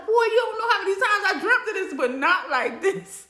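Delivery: loud, high, laughing outburst; hammed-up protest, slowing on the last words; comic exasperation. Patient's flustered inner outburst.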